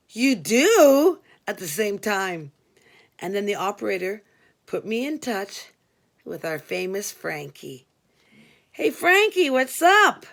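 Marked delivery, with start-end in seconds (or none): 0.1-1.2 higher, louder shocked question; gasped surprise. Retold or imitated. Frankie and the operator both shocked that the call was accepted. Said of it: "gasped out". 8.7-10.3 much higher, louder chummy hello; long-lost friend greeting. Retold or imitated. Narrator greeting the inmate on the phone like an old pal. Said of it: "greeted him like a long-lost friend".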